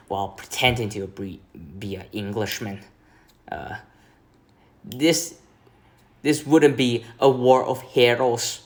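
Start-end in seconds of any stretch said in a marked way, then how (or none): 4.8-8.6 put-on a rough, amateur Scottish accent, a bit louder; quoting the villain in a clumsy Scottish accent; attempted Scottish accent. Reviewer quoting the Shepherd villain in a butchered Scottish accent. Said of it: "I butchered the accent again".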